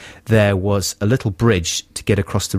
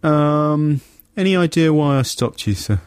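officious cop; 6 semitones higher, slower than usual. Retold or imitated. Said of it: "He goes".

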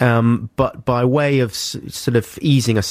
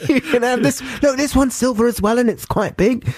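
much higher, laughing mock alarm at the coin; laughing mock panic. Father hamming up a swap of coins at the wishing well, much higher and laughing. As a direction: play the stingy dad swapping coins, giggling.

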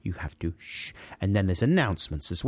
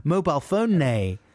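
calling out; 8 semitones higher, slower than usual. Retold or imitated. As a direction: rap it over the seats at them. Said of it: "I'm rapping across my words".

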